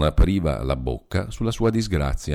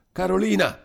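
calling out; 13 semitones higher than usual. A narrator's reading. Paolino calling his sister again, high and frantic. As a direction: yell for her once more, frantic.

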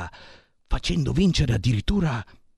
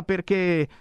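ordinary talk

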